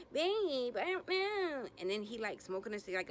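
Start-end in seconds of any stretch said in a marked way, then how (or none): 0.1-1.6 very high, drawn-out helpless whine; mock whiny girlfriend. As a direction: exaggerate the flustered girlfriend, pitched way up. Girlfriend voiced, pressed by boyfriend.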